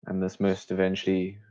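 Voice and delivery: usual speaking voice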